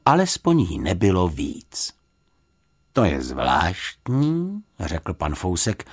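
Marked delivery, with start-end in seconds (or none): 2.9-4.6 slow, pondering remark; musing, unhurried voice; thoughtful puzzlement. A narrator's reading. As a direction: draw it out as if puzzled. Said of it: "zamyšleně si promnul čelo".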